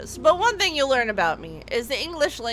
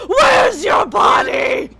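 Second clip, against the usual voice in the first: slow, much higher, louder mom yell; mock yell; 10 semitones higher, 8 dB louder, slower than usual. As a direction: demand it like the frantic mom. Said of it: "I ask you again".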